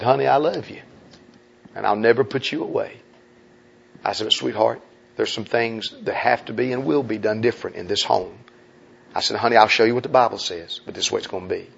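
soft, low tender firmness; tender but firm. Retold or imitated. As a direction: speak gently to a wife, yet firmly. Husband tells wife tenderly.

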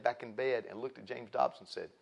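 ordinary talk

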